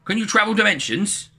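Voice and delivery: high, loud eager question. Acted. Jim asks eagerly.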